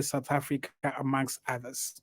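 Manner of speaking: ordinary talk, nothing marked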